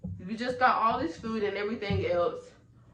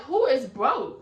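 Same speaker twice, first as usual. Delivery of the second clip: louder, higher outraged question; indignant retort; 6 semitones higher, 7 dB louder, slower than usual. Retold or imitated. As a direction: throw it back at her in disbelief. She retells snapping at Lola.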